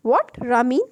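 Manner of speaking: sharp, loud What, rising on the name; surprised. Acted. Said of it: "हैरत से रामीन को देखा". (हैरत से ऊँची आवाज़ में)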